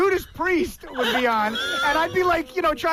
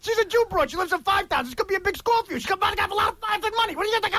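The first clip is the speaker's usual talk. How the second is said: higher pleading Pesci hustle; Joe Pesci voice; 5 semitones higher than usual. Retold or imitated. Acting out Goodfellas with his friend, a pleading Pesci voice. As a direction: wheedle Henry like Pesci in Goodfellas. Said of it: "the only way I can do a good Joe Pesci".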